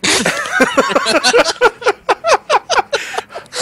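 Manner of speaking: high squealing laugh. Real, not acted. Laugh after friend's hi.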